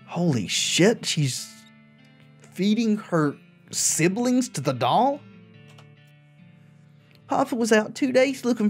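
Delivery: put-on high, childlike diary reading; little girl voice. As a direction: read it like a scared little girl.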